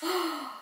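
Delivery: sharp soft intake of breath; delighted gasp. Real, not acted. Showing viewers a new blush, a delighted gasp. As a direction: gasp in pleased surprise. Discovers cheek product, delighted.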